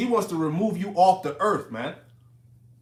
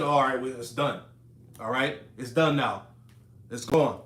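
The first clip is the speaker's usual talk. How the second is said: lower, offhand brush-off; dismissive enemy; 6 semitones lower than usual. Retold or imitated. Mimicking the betrayer who calls it done, lower and offhand. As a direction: shrug it off like it's finished business.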